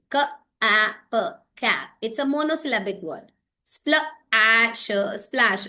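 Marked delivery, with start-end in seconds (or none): 0.1-1.2 "क, आ, प" as separate phonic sounds; sounded out. Shown as a demonstration. Teacher showing a closed syllable, sounding out "क, आ, प". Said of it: "ये word देखिए". 3.7-5.2 "Spl-, a, sh-" as separate phonic chunks; sounded out. Shown as a demonstration. Teacher showing a monosyllabic word, sounding out "Spl-, a, sh-". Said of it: "it's a, a sound".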